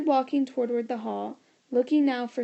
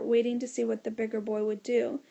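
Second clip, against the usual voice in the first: usual speaking voice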